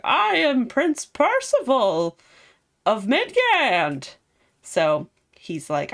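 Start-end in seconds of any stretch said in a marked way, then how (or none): 0.0-4.1 grand prince. Retold or imitated. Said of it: "he's all like".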